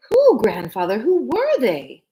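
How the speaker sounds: put-on a girl demanding answers; eager child. Usha pressing grandfather, high and eager.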